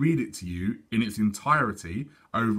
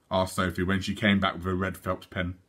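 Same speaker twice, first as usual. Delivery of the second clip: ordinary delivery, nothing marked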